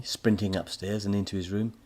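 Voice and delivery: ordinary delivery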